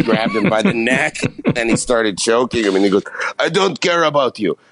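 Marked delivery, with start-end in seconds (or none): 3.4-4.6 higher, hammed-up French accent; mock French-Canadian accent. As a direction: brush the interviewer off in the mock French voice. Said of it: "he would talk like this".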